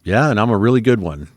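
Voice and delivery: a touch of swagger, retold; cocky. Tipsy instructor answering the young men's leader about firearms, cocky. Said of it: "being the cocky guy that I".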